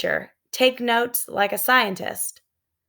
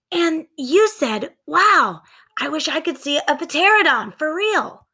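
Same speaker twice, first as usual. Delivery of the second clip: put-on excited little girl voice; 8 semitones higher than usual.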